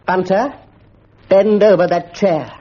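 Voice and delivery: slow, heavy command; stern order. A narrator's reading. Mister Quelch orders Bunter over the chair sternly. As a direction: lay down the law.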